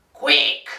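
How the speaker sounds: "Quick" as a loud, low quack; mispronounced quack. Shown as a demonstration. Duck testing the pig's letter, "Quick" honked low and loud. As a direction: honk out "Quick" like a duck call.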